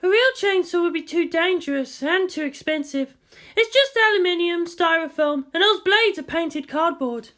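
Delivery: high, light female voice, teasing compliment; Kodama's voice. Acted. Kodama to Makoto in costume, high playful approval.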